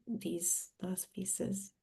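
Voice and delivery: usual speaking voice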